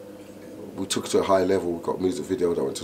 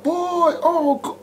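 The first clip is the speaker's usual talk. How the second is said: very high, louder amazed exclamation; imitated hype-up; 5 dB louder, much slower than usual. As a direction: squeal it like a hype man boosting a mate. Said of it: "and boost you".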